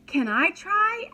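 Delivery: put-on high, loud eager child voice; eager little boy. A narrator's reading. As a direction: beg like an excited kid.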